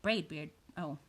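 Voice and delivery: ordinary delivery, nothing marked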